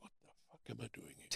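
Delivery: unvoiced, fast whisper to himself; a quick, breathy aside to yourself; whispered thought. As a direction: hiss it under your breath in dismay. Inner thought, dismayed on arrival.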